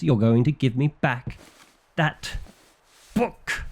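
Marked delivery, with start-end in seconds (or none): 2.0-3.6 that book, with book rising much higher; drawn-out demand. Acted. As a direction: stress BOOK, pitching it up sharply.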